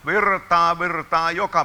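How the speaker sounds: high, loud shout of virtaa; ecstatic shout. Real, not acted. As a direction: shout it out in rapture. Peak of the ministry. (hurmoksellinen huuto)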